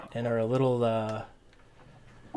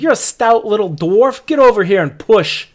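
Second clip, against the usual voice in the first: high, loud bossy shout; laborer yelling at dwarf; 8 semitones higher, 11 dB louder than usual. Acted. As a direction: holler at the stout dwarf to come push. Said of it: "he's yelling at you".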